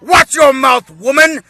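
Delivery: high, loud scolding shout at his wife; mock-furious husband yelling; Vegeta. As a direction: order her sharply, at full volume. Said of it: "here's Vegeta telling his wife".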